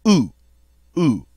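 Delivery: "Ou" said oo as in two; two separate short "ou" sounds. Shown as a demonstration. Teacher drills French O U, oo sound. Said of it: "Like the double O in the word two".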